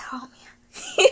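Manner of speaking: very high, teary squeak; helpless frustrated whimper; tearing up from frustration. Real, not acted. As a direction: near tears, whispery and exasperated. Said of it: "I'm tearing up from frustration".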